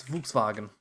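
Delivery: "Volkswagen" with the W said as V; quiet German-style "Volkswagen", W turned to V; German pronunciation. Shown as a demonstration. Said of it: "Their W's become V's".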